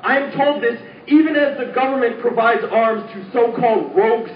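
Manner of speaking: intense, rhythmic protest poem delivery; spoken word poem. Acted. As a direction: declaim it like a slam poet.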